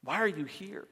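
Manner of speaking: very soft, hushed questioning; gentle hushed voice; whisper. Acted. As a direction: drop to a near-whisper, leaving room between the questions. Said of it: "in a whisper".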